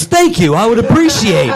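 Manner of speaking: high, laughing fake-polite voice; retelling a drunk's sudden politeness, giggling; laughing mock politeness. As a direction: sweeten it through laughter. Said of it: "he goes".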